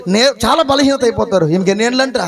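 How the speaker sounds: normal talking voice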